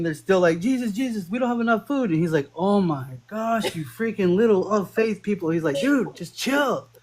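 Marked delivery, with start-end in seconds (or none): 0.6-2.1 higher, mock-pleading disciples; whiny disciples. Disciples begging Jesus, retold. 2.5-5.5 slow, higher, exasperated groan of a line; mock exasperated Jesus. Jesus exasperated, retold comically. 5.8-6.9 slow, higher surfer-dude chill; laid-back dude Jesus. Retold or imitated.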